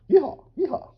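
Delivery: loud high yeehaw; cowboy yeehaw. Pretend. Joking about his Scottish roots, loud whoop.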